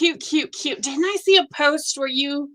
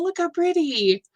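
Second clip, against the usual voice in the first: ordinary talk, nothing marked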